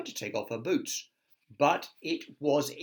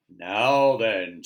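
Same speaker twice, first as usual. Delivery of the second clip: louder stern warning; 10 dB louder than usual. Acted. Wilfrid warning Miss Bossy, louder and stern. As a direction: warn her like a strict wizard. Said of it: "said Wilfrid sternly".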